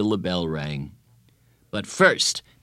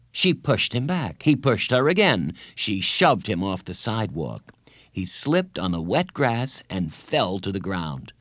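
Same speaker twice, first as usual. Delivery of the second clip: ordinary delivery, nothing marked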